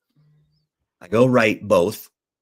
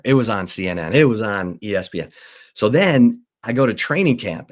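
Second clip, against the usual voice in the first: ordinary talk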